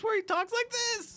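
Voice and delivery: very high, slow, put-on voice; lilting voice caricature. Guest showing how the impression of him sounds, high lilting caricature. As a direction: send it way up high and stretch it out. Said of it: "usually sounds kinda like this".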